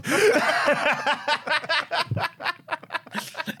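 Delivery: high, soft giggle; real laughter; high burst of laughter. Real, not acted. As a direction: laugh it off at a joke. Laugh after salted land.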